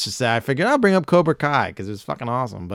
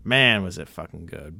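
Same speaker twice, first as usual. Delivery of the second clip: usual speaking voice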